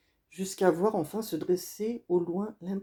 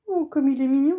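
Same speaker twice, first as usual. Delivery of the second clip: higher, louder delighted gush; gushing exclamation; 7 semitones higher, 8 dB louder than usual. Acted. Woman at the house gushes over the boy, high and loud. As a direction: coo over the cute boy. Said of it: "s'exclame une femme".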